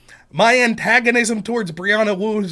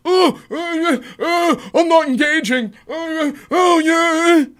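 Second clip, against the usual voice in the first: whiny mocking voice, higher; a dismissive online opponent, mocked in a mildly higher whine; mocking impression of her; 5 semitones higher, 4 dB louder than usual. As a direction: sneer out her lines in a put-on high voice. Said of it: "She just says".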